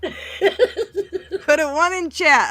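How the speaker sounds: slow, high laughing plea. Real, not acted. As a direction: laugh while asking. Laughing hard.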